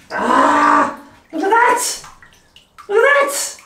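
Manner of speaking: thrilled, squealing at the dog; excited. Real, not acted.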